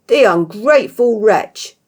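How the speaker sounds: slow, loud furious roar. A narrator's reading. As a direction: spit it out in rage. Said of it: "he roared".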